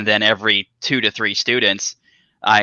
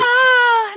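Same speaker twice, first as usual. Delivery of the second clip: mock sexy moan; 4 dB louder than usual. Mock sexy noise for ASMR.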